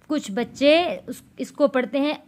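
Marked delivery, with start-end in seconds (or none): none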